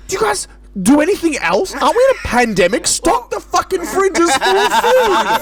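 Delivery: high, loud mock outrage at the shop; retold shop rant, voice climbing; exasperated rant. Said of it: "I was like".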